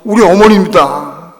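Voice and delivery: imitated weeping reply; retold tearful answer. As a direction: answer as if through tears, acted. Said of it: "울면서".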